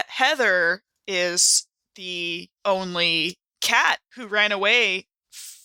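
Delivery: ordinary delivery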